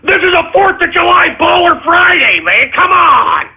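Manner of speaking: high-pitched indignant yell; exasperated shout. Real, not acted. Host protests the ruined show.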